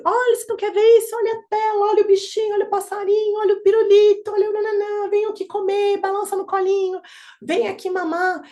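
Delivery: put-on high, loud, sing-song baby talk; distracting parent. A parent trying to stop a baby crying, high singsong baby talk. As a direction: dangle every distraction at the baby, pitched high.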